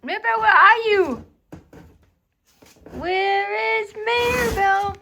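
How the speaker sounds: loud sing-song call for a pet. Real, not acted. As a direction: call out loud to find her. Calling out for the pet.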